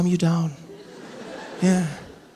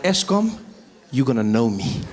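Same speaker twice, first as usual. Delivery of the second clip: much lower, mock-menacing warning; low threat; 7 semitones lower than usual. Angry customer about to phone Eskom, threatening.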